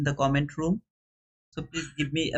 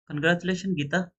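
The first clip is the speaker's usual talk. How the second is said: ordinary delivery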